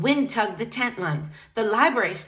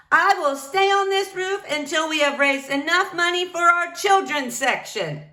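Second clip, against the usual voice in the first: higher, firm announcement to the crowd; bullhorn declaration; 8 semitones higher than usual. Acted. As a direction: proclaim it from the roof. Librarian announces through bullhorn.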